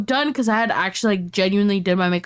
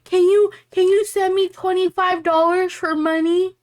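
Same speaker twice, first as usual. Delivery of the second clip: high-pitched mocking plea; mock pleading; 9 semitones higher than usual. Friend mimicking a man asking her for money, mock pleading. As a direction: imitate a whiny beggar, pitched way up.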